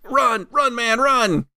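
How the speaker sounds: high, urgent yelp; fleeing thug yelling run in panic; panicked order to run. Acted. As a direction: squeal at him to flee. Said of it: "panics a little bit and goes".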